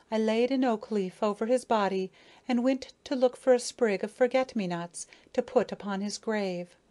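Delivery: plain reading voice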